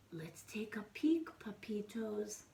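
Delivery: hushed whisper; breathy secret whisper to the pups; whispered peek. Acted. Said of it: "said Scipito".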